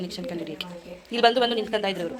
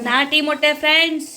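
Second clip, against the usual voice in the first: higher, louder show-host call, punching friends; emphatic announcing; 5 semitones higher, 6 dB louder than usual. Real, not acted. Sister presents country eggs to viewers, loud and bright. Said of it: "ಹಂಗೆ ಮಾತಾಡ್ಬೇಕು".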